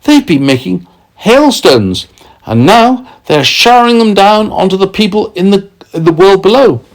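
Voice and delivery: put-on high eager boy voice; lightly voiced excited boy; excited boy James. A narrator's reading. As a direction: tell them the news eagerly. James character.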